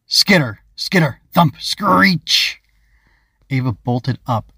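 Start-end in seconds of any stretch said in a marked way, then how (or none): 0.1-2.6 loud, higher punched noise words; sudden startling noises, acted out; dramatic sound effects. A narrator's reading. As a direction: burst out each sound, climbing to the screech. Narrator acts out sudden noises.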